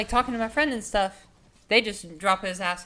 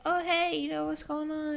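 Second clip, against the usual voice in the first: pitched-up cheery greeting to smooth things over; acting casual and friendly, a little high; bright friendly hello; 8 semitones higher than usual. Friendly greeting to defuse tension.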